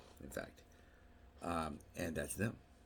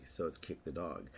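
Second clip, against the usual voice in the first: ordinary delivery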